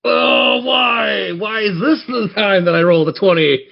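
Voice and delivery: ordinary talk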